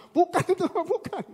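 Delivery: high, quick objection; protesting child. Retold or imitated. Children correcting papa's wrong lyric, high-pitched protest. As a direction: imitate a kid correcting a parent.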